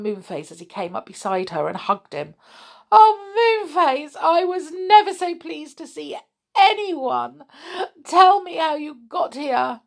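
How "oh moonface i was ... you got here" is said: put-on high, gushing girl's voice; relieved little girl. A narrator's reading. Connie hugging Moonface, hugely relieved. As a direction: pour out relief in a high, loud voice.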